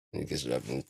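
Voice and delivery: ordinary talk, nothing marked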